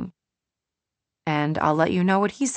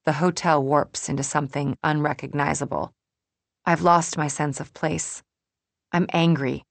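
plain reading voice

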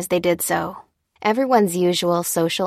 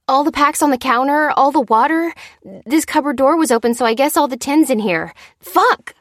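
high, loud panicked inventory; distressed cry; 8 semitones higher, 5 dB louder than usual. A narrator's reading. Lucy telling Dan what neighbors saw, crying out distressed. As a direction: list it in alarm, bursting into a curse. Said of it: "she cried".

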